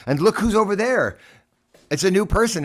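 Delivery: high, loud, delighted; bright surprised welcome; excited greeting. Real, not acted. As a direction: announce the new arrival with a burst of glee. Excitedly spotting a guest.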